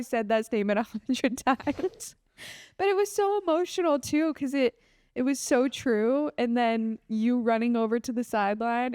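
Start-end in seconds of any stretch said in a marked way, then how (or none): none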